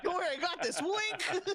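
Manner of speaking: high, laughing mock voice with a wink; mock coworker. Friend acting out a coworker, high and giggly. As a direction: play the clueless coworker, giggling.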